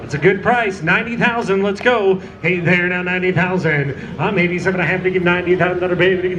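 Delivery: ordinary delivery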